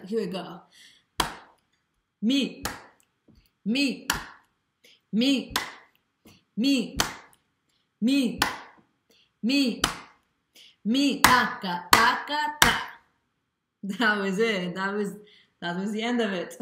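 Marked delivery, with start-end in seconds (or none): 2.2-12.8 each "Mi" punched louder and higher; accent on mi. Shown as a demonstration. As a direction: hit every "Mi" hard on the beat. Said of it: "Emphasizing mi".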